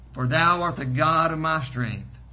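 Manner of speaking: ordinary delivery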